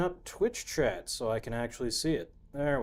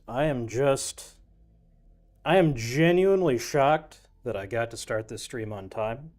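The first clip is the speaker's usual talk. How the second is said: ordinary talk, nothing marked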